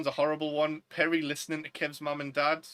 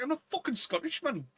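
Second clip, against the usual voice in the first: annoyed retort; 10 semitones higher than usual. Retold or imitated. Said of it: "but it's just like".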